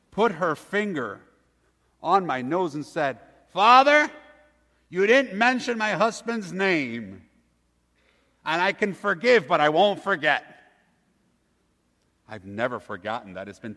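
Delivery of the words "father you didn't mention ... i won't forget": loud, high indignant telling-off; mock outraged old lady scolding the young priest; scolding widow. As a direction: wag a finger and scold the priest.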